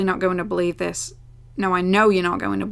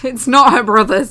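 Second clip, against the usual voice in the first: laughing; 2 semitones higher, 8 dB louder than usual. Real, not acted. Laughing at own slip.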